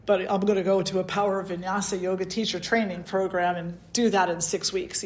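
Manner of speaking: ordinary delivery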